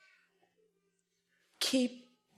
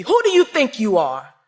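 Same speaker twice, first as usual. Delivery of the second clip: loud, higher roar; father's yell; 4 semitones higher, 10 dB louder than usual. Retold or imitated. Tall father yelling at his child, loud and higher. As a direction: bellow it down at him. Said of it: "This is how he said it too".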